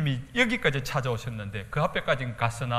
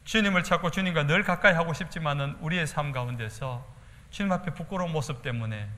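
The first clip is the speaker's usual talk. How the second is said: normal talking voice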